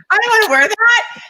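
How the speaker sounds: very high, loud whiny protest; whiny refusal. Retold or imitated. Guest imitating her refusal of the bikini, squealing whine. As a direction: whine it like a stubborn little kid.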